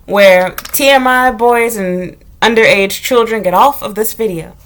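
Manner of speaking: ordinary talk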